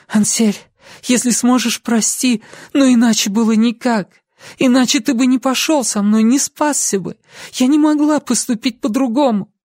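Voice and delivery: high-pitched, urgent pleading; pleading young woman. A narrator's reading. Lucy begging Ansel to forgive her, pleading.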